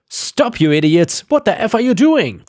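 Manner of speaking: high yelled shout at the tree crew; screaming at crew. Retold or imitated. Owner yelling at tree cutters to stop: loud shout. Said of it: "I ran outside screaming".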